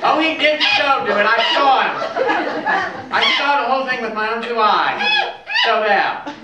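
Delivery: high, loud tattletale snitch; a petty informant ratting him out; stool pigeon. Acted. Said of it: "That's the stool pigeon".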